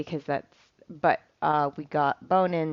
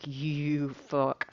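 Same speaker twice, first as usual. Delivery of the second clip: lightly voiced dog's low growl of a curse; a hint of a fed-up dog muttering at the puppy; grumbling dog; 4 semitones lower, 7 dB quieter than usual. The dog curses at the pestering puppy, lightly voiced low grumble. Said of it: "raised a lip a little bit like".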